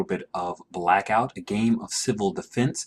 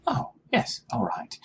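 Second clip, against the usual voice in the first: excited hushed whisper; 5 dB quieter than usual. Pretend. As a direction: whisper it in awe. Player whispers in character.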